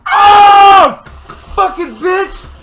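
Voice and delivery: real high-pitched shout of pain; pained outburst. Real, not acted. Friend reacting to a plucked hair, pained yell. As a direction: cry out loudly at a sudden sting.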